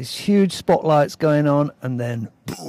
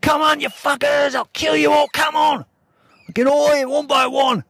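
much higher, louder furious threats; enraged shouting; 12 semitones higher, 3 dB louder than usual. Retold or imitated. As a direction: scream threats at the whole group. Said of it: "I went nuts".

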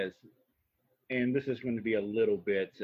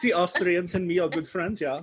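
put-on playful higher-pitched Austrian accent; 6 semitones higher than usual. Joking with co-host after praise, mock Austrian accent. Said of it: "That was a good Austrian accent".

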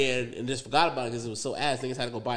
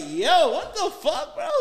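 laughing disbelief; 7 dB louder than usual. Retold or imitated. Watching cops tear the car apart, a laughing high outcry. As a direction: cry out in amused outrage.

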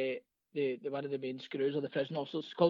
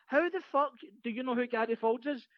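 higher-pitched mock incredulity; retold disbelief, pitched up; incredulous challenge; 7 semitones higher than usual. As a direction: imitate a guard questioning a prisoner. Screw challenges the addict, retold.

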